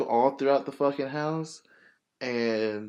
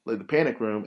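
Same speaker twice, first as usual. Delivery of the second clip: ordinary delivery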